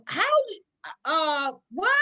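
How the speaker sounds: imitated high, loud stammer of shock; upset disbelief. Major learns she got into training, imitated flustered disbelief. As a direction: mimic a boss caught off guard. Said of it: "I knew he was so upset".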